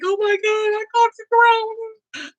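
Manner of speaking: high, whiny retold wail; hammed-up tearful panic; mock crying. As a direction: imitate yourself about to burst into tears. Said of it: "I was about to cry".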